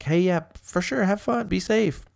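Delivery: high gentle permission; mock easygoing boyfriend. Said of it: "if you were like".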